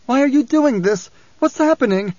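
high, distressed questioning; frantic pleading. A narrator's reading. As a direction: plead as if possessed and bewildered. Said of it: "cursing, pleading".

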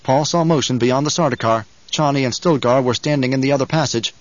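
ordinary delivery, nothing marked

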